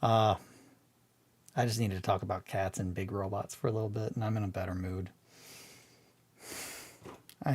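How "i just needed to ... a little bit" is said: ordinary talk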